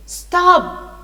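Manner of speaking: high, loud sudden shout. A narrator's reading. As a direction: cut in with a commanding cry. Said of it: "very loud voice".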